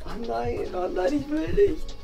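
ordinary talk, nothing marked

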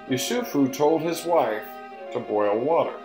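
usual speaking voice

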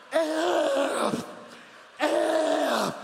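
high, loud hollered curse; imitated yell. Son yelling from the front porch, loud imitated holler. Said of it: "stands on the front porch and yells".

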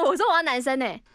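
ordinary talk, nothing marked

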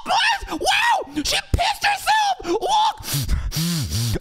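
mocking chat with a fake squeal; mock excited gasp. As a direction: ham up a giddy fan squealing. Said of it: "and just go".

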